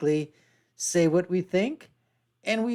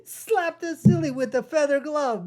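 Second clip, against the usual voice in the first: high laughing words; 8 semitones higher than usual. Real, not acted. Host laughing at a light insult, words through laughter. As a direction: laugh it out while talking.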